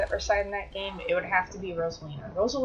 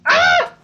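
startled scream; 13 dB louder than usual. Real, not acted. Attacker appears suddenly.

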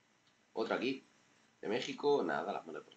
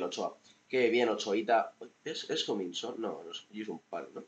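usual speaking voice

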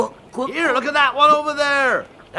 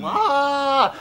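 usual speaking voice

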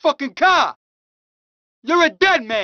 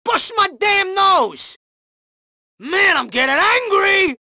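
angry shout; 6 semitones higher than usual. Acted. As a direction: bellow it, nose just busted. Thug hit in the face.

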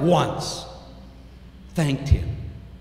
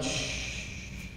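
unvoiced hush; soft shushing; 16 dB quieter than usual. Mother hushing her son, whispered shh. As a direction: breathe a long soothing shh.

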